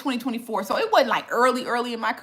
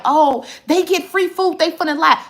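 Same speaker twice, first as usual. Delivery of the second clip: mocking the doubters; 7 semitones higher, 4 dB louder than usual. Creator imitating viewers who doubt free-food reviews, mocking. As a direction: imitate gossiping sceptics in a raised pitch. Mimics suspicious people's comments.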